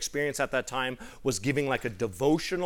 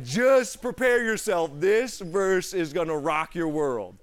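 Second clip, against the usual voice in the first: slow, loud, hyped-up announcement; dramatic buildup; 4 semitones higher, 7 dB louder, slower than usual. Retold or imitated. Zane hyping up his friends before reading the verse. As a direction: build suspense before the reveal.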